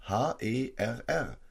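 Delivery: H E R R, each letter spoken slowly and apart; spelled out letter by letter. Shown as a demonstration. Preacher spelling the capital word to his listeners, slow and deliberate. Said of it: "Seht, es ist ein Großbuchstabe".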